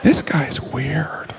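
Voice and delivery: slow whispered judgment. Acted. The audience whispering about the comic, slow. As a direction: whisper it slowly, judging.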